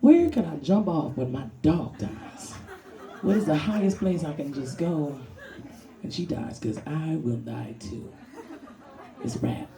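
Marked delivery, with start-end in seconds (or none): 0.0-8.1 slow, drawn-out lament; mock despair. Comic imagining dog's death, slow mock despair. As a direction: drag it out, mock tragic. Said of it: "I'm like".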